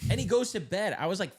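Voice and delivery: ordinary talk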